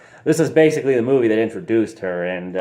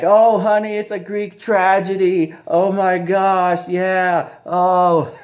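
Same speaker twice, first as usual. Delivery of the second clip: high, slow, hammed-up pleading; mock husband; 10 semitones higher, 4 dB louder, slower than usual. Husband excusing himself to his wife, high mock whine. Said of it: "he's all like".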